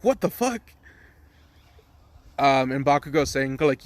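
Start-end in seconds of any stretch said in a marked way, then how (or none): 0.0-0.6 very high disbelieving question; high-pitched imitated astonishment; mock shock. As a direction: act out a stunned class, pitch jumping up. Whole class reacts in shock.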